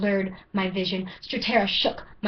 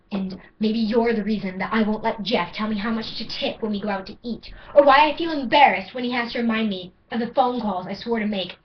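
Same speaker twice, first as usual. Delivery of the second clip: ordinary talk, nothing marked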